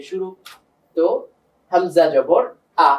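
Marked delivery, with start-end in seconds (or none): 1.7-2.9 slow, clear হামজা জবর আ, each sound spelled out; teacher spelling out হামজা জবর আ for students; demonstrated hamza with fatha. Shown as a demonstration. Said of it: "এইভাবে পড়তে পারি".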